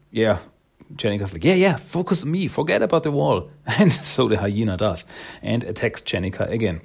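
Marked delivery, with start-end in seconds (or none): 1.4-3.5 fast, higher-pitched urging; acted hurried coaxing; urgent distraction. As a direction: grab its attention in a quick, raised pitch. Heroine draws hyena's attention.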